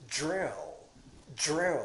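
"Drill" with the tongue curled back on the dr; "Drill", a ju sound with a retroflex tongue. Shown as a demonstration. Teacher modelling "drill" with a curled tongue. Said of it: "舌を反らせながら".

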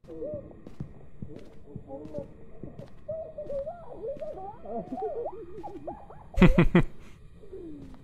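shrill screaming laugh; high shrieking laugh. Real, not acted. Clip shown after intro.

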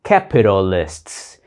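teacher drilling the final sts; final sts sound. Shown as a demonstration. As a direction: land the final s-t-s of Capitalists clearly. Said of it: "âm sờ tờ cuối".

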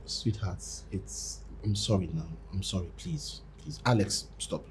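low, soft apology to a partner; hushed pleading. Acted. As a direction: plead quietly to keep the peace. Placating partner, hushing friend.

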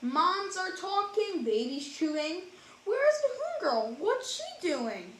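lively, sing-song storybook reading; reading to children. A narrator's reading. Reading a rhyming picture book to kids, animated. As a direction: read it like bedtime for little ones.

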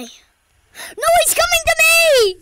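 high, loud panicked cry; panicking gamer. Real, not acted. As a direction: scream as the monster closes in. Monster chasing the player.

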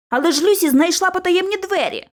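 interjecting protest. A narrator's reading. George cuts in about Lucy's secret door, insistent protest. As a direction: butt in to argue. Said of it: "втрутився Джордж".

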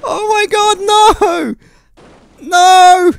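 very high, loud screams of dismay; shrieked real dismay; anguished screaming. Real, not acted. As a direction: scream in despair at losing. Run fails at last moment.

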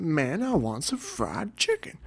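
high, slow put-on voice, mock angry; mock angry Joe. Joe complaining to Steve about dinner, hammed-up mock anger. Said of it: "would get angry and he'd be like".

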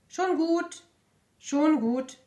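high, loud sparrow calming the others; soothing sparrow. Acted. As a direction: pipe up to settle things down. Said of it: "beruhigt der Spatz".

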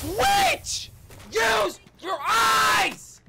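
screamed high and loud at a teammate; furious shout. Real, not acted. Player screaming at teammate Twitch to use her eyes, furious shout. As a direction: yell it across the room, fed up. Yelling at teammate after dying.

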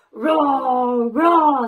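dinosaur roar. Acted. Dinosaur roaring at the children in the story. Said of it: "said the dinosaur".

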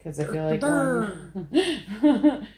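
high, loud, drawn-out silliness; slow, loopy and high-pitched; playful lunatic. Real, not acted. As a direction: let it tip into giddy laughter. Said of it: "Do I sound like a lunatic?".

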